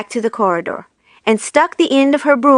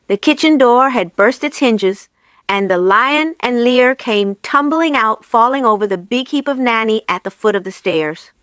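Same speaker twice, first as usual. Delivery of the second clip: usual speaking voice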